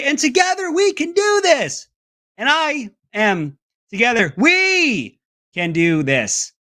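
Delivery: wildly jumping pitch, high then slow and shouted; erratic inflection. Retold or imitated. Host mocking a candidate's broken inflection, high and erratic. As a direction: lurch the tune all over the place. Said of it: "all over the place with this inflection".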